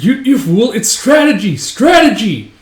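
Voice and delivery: high, loud insistence; acted indignant yell. Follower answers doubters of the leader, indignant yell. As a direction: defend your hero at full voice.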